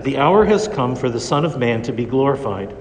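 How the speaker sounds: ordinary talk, nothing marked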